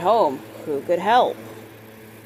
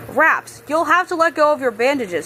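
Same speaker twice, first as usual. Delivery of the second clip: urgent plea; 7 semitones higher than usual. Acted.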